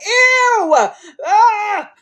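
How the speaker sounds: high, loud disgusted shriek through laughter; laughing scream. Real, not acted. Narrator recoils from the ghost smile, laughing shriek. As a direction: squeal with horrified laughter. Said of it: "I was like".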